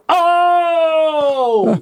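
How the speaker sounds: very high, loud mock Oh; gloating cry. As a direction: shriek it like a kid who just landed a joke. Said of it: "as she kept going".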